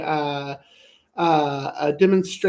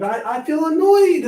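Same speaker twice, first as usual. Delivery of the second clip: announcing annoyance; 8 semitones higher, 5 dB louder than usual. Shown as a demonstration. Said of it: "catching yourself that irritated".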